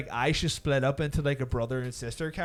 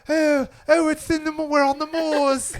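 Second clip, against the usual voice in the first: high, loud hammed-up English accent; bad English accent; 14 semitones higher, 8 dB louder than usual. Mimicking Winona Ryder on the moors, terrible English accent. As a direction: wail it in a mock English accent. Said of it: "Winona Ryder is like".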